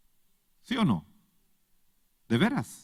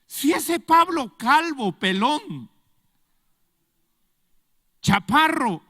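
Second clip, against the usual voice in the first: mocking sneer; 8 semitones higher, 5 dB louder, slower than usual. Sons of Sceva mocking Paul.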